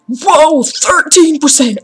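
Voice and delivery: loud, high burst of surprise; surprised whoa. Real, not acted. Gamer cheering install progress, loud surprised shout.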